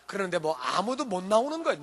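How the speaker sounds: ordinary talk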